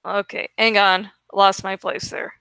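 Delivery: ordinary delivery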